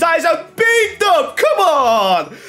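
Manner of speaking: high-pitched, shouted excitement; ecstatic celebration. Real, not acted. Gamer screaming at pulling Desailly from a pack, ecstatic. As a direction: yell it out high, stretching the big cries.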